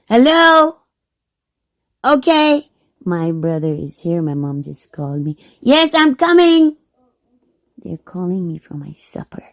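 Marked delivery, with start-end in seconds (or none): 0.1-2.7 real high, loud call to another room. Real, not acted. Answering mom who just called her, calling out loudly. Calling back to family mid-recording. 5.6-6.8 real high, loud holler; calling out. Real, not acted. Telling mom she is coming for supper, calling out loudly.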